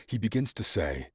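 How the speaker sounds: usual speaking voice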